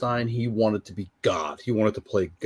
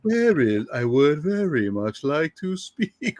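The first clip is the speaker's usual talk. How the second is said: higher, drawn-out mock speech breaking into laughter; slow impression; 4 semitones higher, slower than usual. Galadriel's husband asking to speak, mockingly slow. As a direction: stretch the words in a slow impression. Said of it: "talked very slow".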